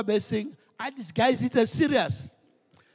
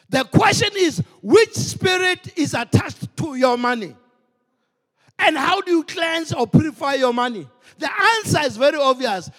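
full-force preaching; 3 semitones higher, 4 dB louder than usual. Acted. Preacher urging the congregation to give first to the Lord, fervent. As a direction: drive each line home like a revival preacher.

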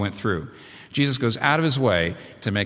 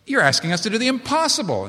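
high mock-sarcastic retort; sarcastic disciples; 10 semitones higher than usual. Disciples sarcastically answering Jesus, higher and scoffing. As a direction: scoff it back at Jesus as an impossible ask. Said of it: "honest about the sarcasm".

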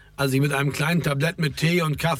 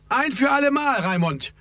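high, exasperated outburst; heated but not shouted; agitated call; 11 semitones higher than usual. A narrator's reading. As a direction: put your foot down, pitch jumping up high. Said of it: "rief Ferdi erregt".

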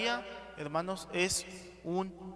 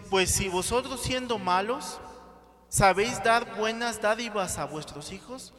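loud scripture reading; 6 dB louder than usual. Acted. Preacher reads scripture aloud.

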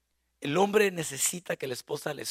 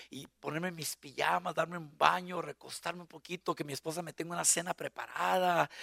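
ordinary talk, nothing marked

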